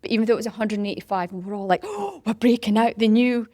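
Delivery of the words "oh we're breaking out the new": mock-thrilled announcement, louder and higher; excited. Volunteers marvel at the new tokens, excited. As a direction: retell it with a gleeful lift. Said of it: "we was all getting excited".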